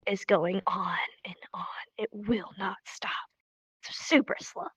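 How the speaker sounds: whispered, weary mock complaint; hushed exasperation. Gamer waiting on a world loading, whispered exasperation. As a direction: drop to a whisper as if it will never end.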